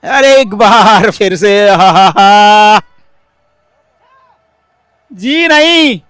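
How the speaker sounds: high, loud exclamation; excited cricket commentary. Real, not acted. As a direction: yelp at the missed chance. Chance missed, rising call.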